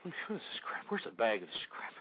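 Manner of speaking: very soft, confused mumble; hushed, bewildered self-talk; muttering to himself. Pretend. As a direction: mutter while searching for something. Muttering while hunting for the bag.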